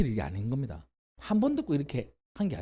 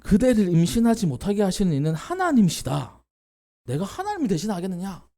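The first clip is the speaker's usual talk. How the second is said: louder, higher stern rebuke; angry rebuke; 6 semitones higher, 5 dB louder than usual. Retold or imitated. Jacob rebuking Rachel, mildly angry and stern. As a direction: scold her as a furious husband. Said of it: "성을 썩 내는 거예요".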